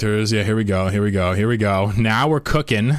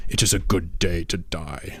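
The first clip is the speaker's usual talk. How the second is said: low, solemn alien warrior line; Corsair; 4 semitones lower, 4 dB quieter than usual. Acted. Streamer quoting a burning Protoss pilot, low solemn voice. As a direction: drop the pitch and declare it gravely. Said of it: "when the Corsairs burn, he says".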